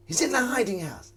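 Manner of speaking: "He's in the hiding house" high, northern sing-song; Northern English voice. Shown as a demonstration. Puzzling over a chat line, trying it Northern. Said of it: "'Cause it sounds northern".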